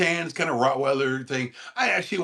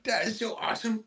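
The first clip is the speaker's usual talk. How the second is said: dog talk; 8 semitones higher, 4 dB quieter, faster than usual. Real, not acted. Praising dog in baby voice.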